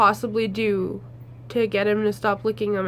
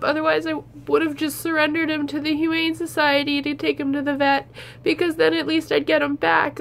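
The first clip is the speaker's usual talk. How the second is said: higher, tearful and pleading; talking through tears, apologising; crying; 4 semitones higher than usual. Real, not acted. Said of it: "watching me cry".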